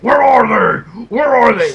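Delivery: loud, high mock Batman growl; Batman's gravelly growl. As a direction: bark it like Batman interrogating. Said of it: "Batman's like".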